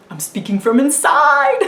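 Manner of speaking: high, hammed-up silly delivery; jokey mock-dramatic. Vlogger poking fun at her own weird wording, jokey mock-dramatic. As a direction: ham it up playfully, pitched well above normal.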